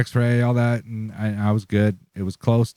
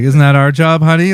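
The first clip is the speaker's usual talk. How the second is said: put-on loud, high old-lady teasing; little old lady; 6 semitones higher, 9 dB louder than usual. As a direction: tease like an old lady, pitched up. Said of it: "They're like".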